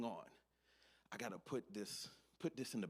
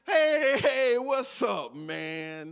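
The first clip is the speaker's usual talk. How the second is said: much louder, higher, stretched-out fake hello; overly loud greeting; 13 semitones higher, 18 dB louder, much slower than usual. Caught worker greeting his boss, loud fake cheer. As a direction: boom it out to cover being caught.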